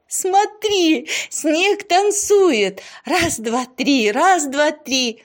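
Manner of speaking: high, loud exhilaration; excited high-pitched waltz count; ringing, laughing delight. A narrator's reading. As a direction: call out joyfully while waltzing. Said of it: "начала вальсировать и звонко рассмеялась".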